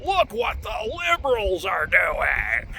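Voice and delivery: slow, drawn-out mock wail; hammed-up whiny outcry; mock screaming. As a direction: imitate a scream without the volume, stretching the words. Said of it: "the conservative tendency to scream".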